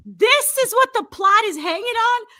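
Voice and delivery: mock outrage. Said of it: "And I was like".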